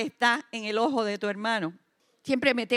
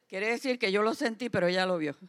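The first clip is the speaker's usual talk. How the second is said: ordinary delivery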